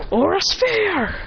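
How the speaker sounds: high, slow anime move call; attack call. Acted. Hero hitting Bakugo, high drawn-out attack call. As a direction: draw out the move name as you fire.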